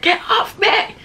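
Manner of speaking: high mock scream, not full volume; retold shout. Storyteller replaying being pinned on the bed, high mock shout. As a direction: squeal it as a reenacted plea. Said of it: "I was shouting".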